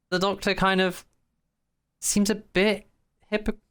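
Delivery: ordinary talk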